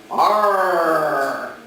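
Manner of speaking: loud, long pirate "Arr"; hammed-up pirate cry with a hard r. Shown as a demonstration. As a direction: let out a drawn-out "Arr" like a pirate. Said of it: "Talk Like a Pirate Day".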